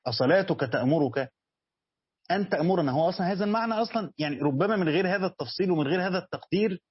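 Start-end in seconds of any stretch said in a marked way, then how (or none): none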